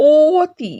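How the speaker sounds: "অতি" with a long, emphatic oh opening; rounded "o" vowel. Shown as a demonstration. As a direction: stretch "অতি" into ooh-ti with a close o. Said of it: "ও এর মতো উচ্চারণ হচ্ছে না". ("অতি"-র প্রথমে লম্বা ও ধ্বনি)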